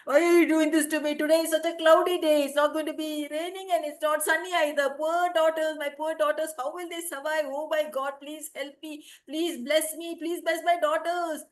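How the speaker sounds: high, loud weeping plea to God; crying prayer. Acted. Praying before the deity, she cries high and loud. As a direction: wail your prayer at the deity. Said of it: "she started to cry".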